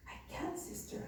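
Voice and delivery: breathless whispered reply; whispered refusal. Retold or imitated. Volunteer whispering back to the nun that they can't leave. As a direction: barely voice it, too tired to argue.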